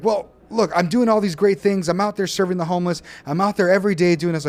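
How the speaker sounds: a hammed-up indignant protest; retold heated friend. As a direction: imitate the friend bristling and defending himself. He's like.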